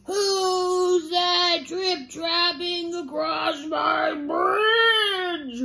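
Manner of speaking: high, slow, drawn-out roar; roaring mean troll. A narrator's reading. Troll challenges the biggest goat, long roaring shout. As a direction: stretch it into a huge troll roar. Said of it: "roared that mean".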